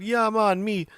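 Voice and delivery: high, slow hammed-up Jamaican accent; imitating a coworker's Jamaican accent; mock Jamaican accent. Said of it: "using a Jamaican accent".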